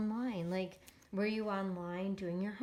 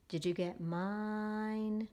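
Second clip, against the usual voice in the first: slow, stretched word reading; drawn-out reading; much slower than usual. Shown as a demonstration. Teacher reading along with the class, slowly stretched. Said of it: "you're gonna read it with me".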